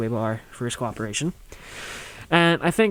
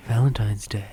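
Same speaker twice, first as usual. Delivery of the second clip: unvoiced whisper of "Valentine's Day"; 4 semitones lower than usual. Shown as a demonstration. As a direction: breathe "Valentine's Day" in a hush. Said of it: "whispered repeat".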